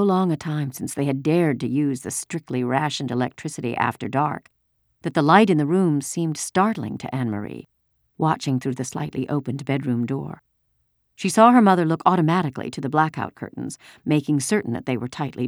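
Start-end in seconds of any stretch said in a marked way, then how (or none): none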